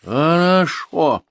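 loud, high, drawn-out word; barely controlled anger. A narrator's reading. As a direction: stretch it slowly, about to lose composure. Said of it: "выдержка едва не подвела комиссара". (едва сдерживаясь)

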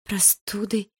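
тонко, жалобным голосом; выпроси ответ, жалобно и робко. A narrator's reading. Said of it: "жалобно спросила Малиновская". (жалобный высокий вопрос)

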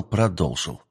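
ordinary delivery

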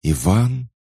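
high, loud disbelieving cry of his name; stunned question. Acted. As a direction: gasp out his name in shock. Sister recognises lost brother.

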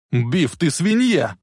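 high, drawn-out angry insult; outraged cry. Acted. Lorraine on the floor after Biff's shove, outraged cry.

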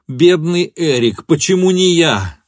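loud, high wail of grief; anguished cry. A narrator's reading. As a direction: cry out on the edge of sobbing. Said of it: "И он громко зарыдал".

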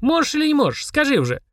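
put-on high, insistent child voice; pestering child. A narrator's reading. Children pestering the demon, high pestering voice. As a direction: badger him like a small child. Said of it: "не отставали тем временем Денис и Полина".

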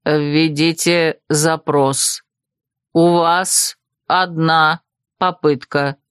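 slow, low robotic monotone; mechanical voice. A narrator's reading. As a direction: speak like an automated system, word by word. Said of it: "А голос повторил".

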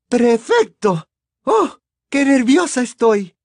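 high, loud, flustered mother with stretched words; madre emocionada. Acted. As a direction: gush proudly in a very high mother's voice. Narrator plays flustered Mrs Weasley. (madre nerviosa y emocionada, muy aguda y fuerte)

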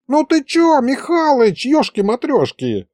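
put-on high, hurt squeal of an old wizard; the old wizard takes offense at the grandpa, squealing high; offended old wizard. A narrator's reading. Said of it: "обиделся вдруг Мировид".

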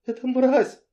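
high sputtered cry; choking with rage. A narrator's reading. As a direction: spit the word out, breathless. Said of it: "захлёбывалась она".

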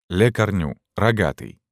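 ordinary delivery

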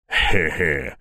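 low, gloating heh-heh; pleased chuckle. A narrator's reading. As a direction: chuckle low, anticipating the show. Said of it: "довольно растянулся в ухмылке Медведь". (низкий довольный смешок)

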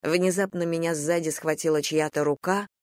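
usual speaking voice

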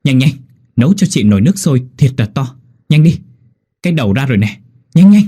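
loud, high urgent shouting; urgent call. A narrator's reading. Midwife urging the husband to boil water, loud and frantic. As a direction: hurry him, voice climbing to a shout. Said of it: "vội kêu với chú Hảo".

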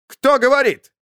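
a high, loud offended cry; indignant outburst. Acted. Lord Senkhum outraged at the insult, high and loud. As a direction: demand it, outraged. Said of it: "возмутился лорд Сенхум".

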